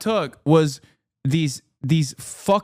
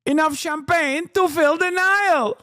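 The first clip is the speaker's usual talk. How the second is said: high put-on accent voice; exaggerated mock Middle Eastern accent, pitched way up; 12 semitones higher than usual. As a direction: deliver it in a high parody accent. Parodied accent voice.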